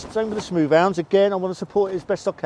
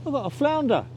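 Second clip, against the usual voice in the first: surprised; 9 semitones higher than usual. Real, not acted. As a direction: pitch jumps way up in disbelief. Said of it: "I wasn't expecting a flounder".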